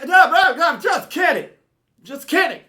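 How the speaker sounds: high, loud, frantic father; panicked backtracking. Retold or imitated. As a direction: take it back urgently, as a rattled dad. Father frantically calming freaked-out son.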